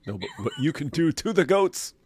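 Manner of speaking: laughing while correcting; laughing punchline. Real, not acted. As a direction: deliver the twist through a laugh. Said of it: "corrects with a laugh".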